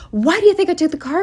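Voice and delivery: high, fast exasperated question. Real, not acted. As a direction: fire it back, high and fast. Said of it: "furious rant at grandma".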